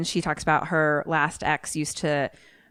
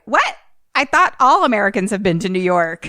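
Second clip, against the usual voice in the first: loud, high mock astonishment; imitated disbelief; 3 semitones higher, 6 dB louder than usual. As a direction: blurt it out as retold shock. Acts out character's surprised disbelief.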